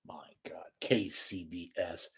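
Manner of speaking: breathy disgusted whisper of the station name; whispered aside. Real, not acted. Host whispering a rival station's name in disgust. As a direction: whisper it like a dirty secret.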